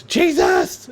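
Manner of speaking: high-pitched mock yell; hammed-up exclamation; retold yell. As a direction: act out a startled yell, pitch shooting up. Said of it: "Buck yells".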